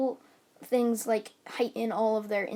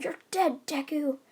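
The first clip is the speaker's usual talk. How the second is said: higher-pitched retold threat; mock battle cry; 5 semitones higher than usual. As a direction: yell it at your rival, lightly. Bakugo attacking Deku.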